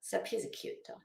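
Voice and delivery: a soft secret aside; whispered aside. Real, not acted. Cooing over her little duck, whispered. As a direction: whisper it fondly under the breath.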